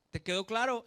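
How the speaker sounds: a loud, firm demand from the father; stern father. Retold or imitated. Father checking his son understood, loud and stern. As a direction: snap the final question like a strict dad. Father ends the phone warning.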